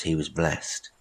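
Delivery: ordinary delivery, nothing marked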